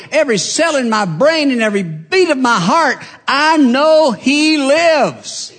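high, loud preaching climax, slowing at the end; fiery preaching. Acted. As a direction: lift it up and preach it out. Preaching at full force.

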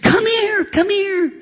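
very high imitated holler; piercingly high mock summons; loud calling. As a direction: call someone back like an excited receptionist.

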